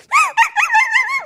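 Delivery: rapid high-pitched dog noise; imitated dog noise. Owner imitating her dog racing out, high excited noise. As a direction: mimic an excited dog in quick high bursts.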